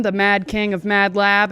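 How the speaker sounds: ordinary delivery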